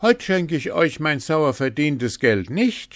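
high, loud indignant protest. A narrator's reading. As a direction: insist loudly on what you are owed. Hans refuses to lose winnings.

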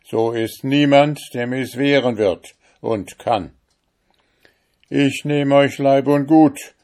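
loud, high, domineering threats; threatening judge. Acted. Richter droht dem Fremden. (laut drohender Richter)

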